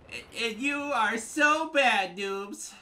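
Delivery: very high, loud, drawn-out whine; mock whiny opponent. Player parroting rival's noob insult, high mocking whine. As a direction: imitate a sore loser at full pitch.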